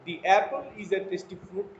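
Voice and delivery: "The apple" with 'The' as thee, slow. Shown as a demonstration. Teacher reads an example to students, The as thee. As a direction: read slowly, The as thee. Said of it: "দি উচ্চারণটা দি এর মতো করেই হবে".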